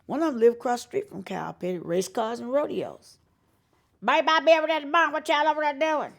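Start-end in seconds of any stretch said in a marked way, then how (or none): 4.0-6.1 put-on higher, fast good-old-boy twang; country buddies' voice. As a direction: toss it off quick like a rural Carolina buddy. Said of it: "these guys that I grew up with".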